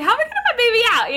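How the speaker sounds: high, fast panicked blurt; retold panic. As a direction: rush it out in a panic. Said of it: "I'm like".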